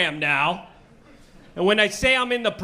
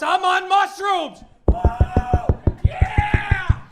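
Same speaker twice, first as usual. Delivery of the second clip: stoned shout; 13 semitones higher, slower than usual. Retold or imitated. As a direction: shout it like a tripping fan. Stoner comic shouting his high.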